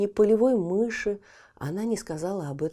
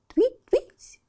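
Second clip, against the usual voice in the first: very high loud chirping call; bird chirp; 6 dB louder than usual. Acted. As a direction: cry твить out like a bird. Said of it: "крикнула в последний раз ласточка".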